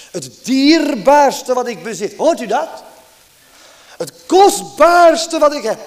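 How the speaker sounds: loud, high, fervent preaching voice; impassioned preaching. Acted. Preacher pressing the Lord's love on his congregation, fervent and loud. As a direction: preach it out with rising fervour.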